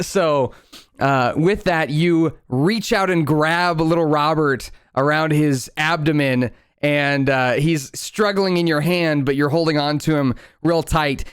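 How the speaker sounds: normal talking voice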